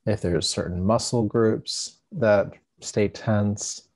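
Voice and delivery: calm, unhurried meditation guide with long pauses; guided meditation. Acted. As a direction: ease listeners into stillness, leaving space between phrases.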